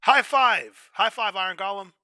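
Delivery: high, loud excited call. Real, not acted. Calling out for a high five to the Iron Golem, loud and excited.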